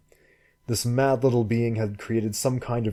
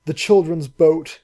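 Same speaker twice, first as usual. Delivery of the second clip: slow, higher, louder wail of grief; 7 semitones higher, 7 dB louder than usual. Acted. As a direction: wail it out through tears. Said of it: "she wailed".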